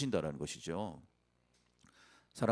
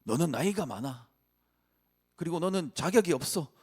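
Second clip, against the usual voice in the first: rising higher, belittling whisper-free taunt; fear's taunt climbing higher and louder; 7 semitones higher, 3 dB louder than usual. Acted. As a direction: doubt growing louder as it scolds. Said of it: "모세의 두려움이 계속해서 모세에게 얘기했던 것이죠".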